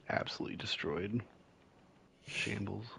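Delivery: normal talking voice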